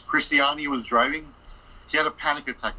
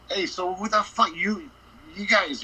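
higher, slow, confrontational challenge in a changed voice; angry manager; 7 semitones higher than usual. Retold or imitated. Hotel manager confronting the guys at reception, angry. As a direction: slowly confront the intruders.

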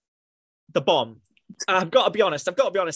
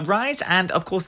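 ordinary talk, nothing marked

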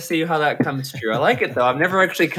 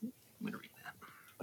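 unvoiced side whisper; 9 semitones higher, 23 dB quieter than usual. Real, not acted. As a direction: whisper it aside. Whispered aside while another talks.

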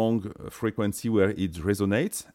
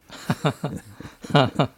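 embarrassed laugh; 5 semitones higher than usual. Real, not acted. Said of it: "It's too stupid".

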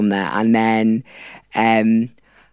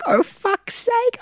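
very high, drawn-out retold groan; imitated exasperation, stretched out high; high mock exasperation; much slower than usual. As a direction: mimic her complaint, slow and squeaky-high. Retold exasperated reply from Queen.

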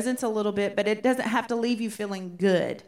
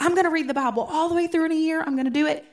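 higher, louder, quick mock-determined vow; retold gung-ho declaration, pitched up; eager resolution; 7 semitones higher, 4 dB louder, faster than usual. Teacher quoting her own past Bible-reading goal, eager.

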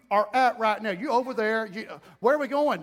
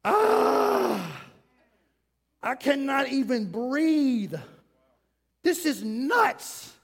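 exasperated morning groan, slowed down; overwhelmed cry; 3 semitones higher, 3 dB louder, much slower than usual. Acted. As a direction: cry out, then let the despair drag. Overwhelmed person waking up, acted.

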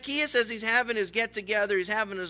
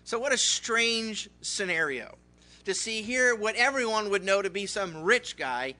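ordinary talk, nothing marked

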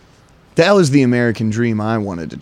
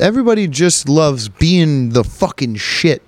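ordinary talk

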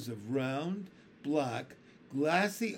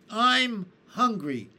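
slow, loud, higher spider voice announcing hunger; sudden announcement; 7 semitones higher, 9 dB louder, slower than usual. A narrator's reading. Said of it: "the spider announced suddenly".

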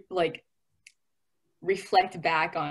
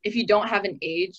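ordinary talk

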